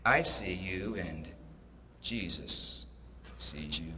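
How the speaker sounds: the unseen voice answers the burglar, low and eerie; eerie voice from the dark. Acted. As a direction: let it creep out of the darkness, low and slow. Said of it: "This voice comes out of the dark again".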